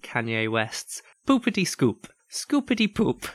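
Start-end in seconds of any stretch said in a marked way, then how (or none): 1.3-3.3 high, bad Irish leprechaun rap. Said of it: "terrible impression of an Irish leprechaun".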